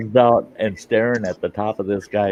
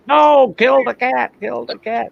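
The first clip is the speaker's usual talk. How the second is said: higher, louder mock yell, fading; brother's yell; 4 dB louder than usual. Brother insisting they kill the cat, retold mock yell. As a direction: shout it like the panicked brother. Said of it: "And my brother's".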